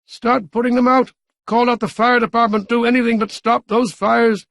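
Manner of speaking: frantic mayor yelling orders; yelled order. A narrator's reading. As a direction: bark it into the radio.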